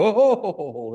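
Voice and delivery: high, loud startled oh ho ho; startled laugh; surprised oh ho. Shown as a demonstration. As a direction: react as if poked. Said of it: "when you're really surprised".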